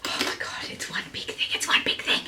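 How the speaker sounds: hushed admiring whisper; soft whispered delight. Real, not acted. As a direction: whisper the point in awe. Admiring the bag.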